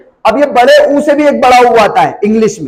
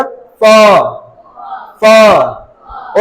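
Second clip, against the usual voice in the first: British फ with long vowel and silent R; British non-rhotic demonstration of फ; British pronunciation. Shown as a demonstration. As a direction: model the British word, dropping final R. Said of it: "आर pronounce नहीं करते हैं".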